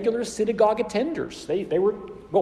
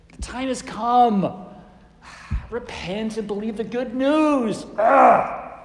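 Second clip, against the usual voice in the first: exasperated sighs, louder, drawn-out delivery; frustrated; 7 dB louder, slower than usual. Shown as a demonstration. Preacher demonstrating a frustrated Jesus, exasperated. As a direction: huff it out, ending in a loud ugh. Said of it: "Is he frustrated?".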